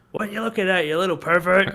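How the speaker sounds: lightly hammed-up indignation; mock outrage. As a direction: imitate an outraged man catching a peeper. Acted angry man catching a peeper.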